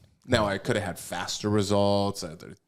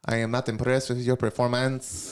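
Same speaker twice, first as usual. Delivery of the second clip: put-on channel GSP with a French-Canadian accent, a touch higher; 5 semitones higher, 3 dB louder than usual. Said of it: "Can you do that accent?".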